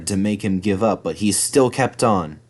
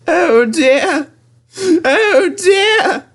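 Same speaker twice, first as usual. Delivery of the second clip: high, loud crying wail; crying engine; 5 dB louder, much slower than usual. Acted. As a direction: break into tears as the lonely engine. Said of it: "James began to cry".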